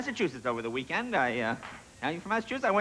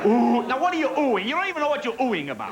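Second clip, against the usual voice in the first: mock indignant; 7 semitones higher, 4 dB louder than usual. Real, not acted. Teasing audience for oohing.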